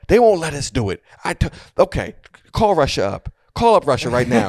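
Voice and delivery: rising irritated retort, quick; annoyed. Retold or imitated. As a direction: snap back, fed up with her. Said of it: "you can hear him getting annoyed".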